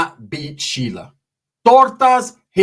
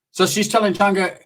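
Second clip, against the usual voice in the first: ordinary talk, nothing marked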